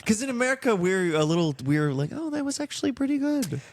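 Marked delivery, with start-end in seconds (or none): 2.1-3.6 high-pitched, polite mock encouragement; feigned polite praise. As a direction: imitate someone politely faking approval, voice lifted high. Voicing Americans' two-faced polite praise.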